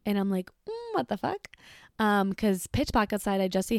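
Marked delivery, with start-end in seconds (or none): 0.7-1.5 high, startled squeak of disbelief; alarmed disbelief. Retold or imitated. Alone at home, spotting strange headlights: high startled disbelief. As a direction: shriek out the question like something's wrong.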